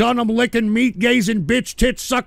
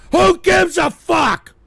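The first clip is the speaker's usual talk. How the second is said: slow, loud, higher angry shout; real angry outburst; angry yell; 7 semitones higher, 4 dB louder, slower than usual. Real, not acted. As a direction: yell it out in disgust. Ranting at chat.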